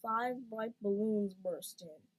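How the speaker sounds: ordinary delivery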